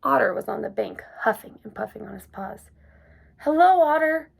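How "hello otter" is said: loud high call across the river; loud cheerful shout. A narrator's reading. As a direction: call out over the wind. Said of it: "shouted Little Hedgehog".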